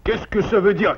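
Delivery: sharp, high angry question; angry demand. Acted. Father confronting his son over the hole, angrily. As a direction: demand an answer from the guilty son.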